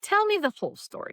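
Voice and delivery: high-pitched mock insistence; curious ophthalmologist. Ophthalmologist asking the practitioner for the full story, high insistence.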